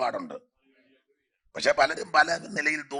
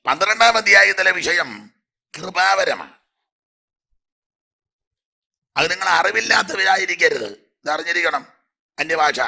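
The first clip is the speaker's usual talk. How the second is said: preaching at full force; 3 semitones higher, 7 dB louder than usual. Real, not acted. Preacher proclaims spiritual gifts.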